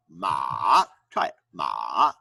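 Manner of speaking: ma falling low then rising; dipping tone. Shown as a demonstration. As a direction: dip ma down and bring it up. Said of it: "going down and up".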